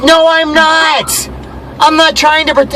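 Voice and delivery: high, slow, drawn-out protest; retold exasperated denial. Retold denial in phone argument.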